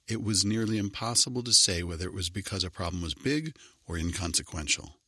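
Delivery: plain reading voice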